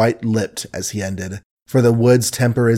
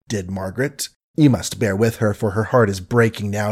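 ordinary delivery, nothing marked

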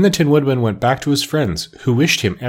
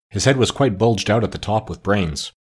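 usual speaking voice

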